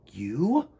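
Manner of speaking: small surprised chick voice; a young bird asking in disbelief; little bird surprised. Acted. Choco character voice.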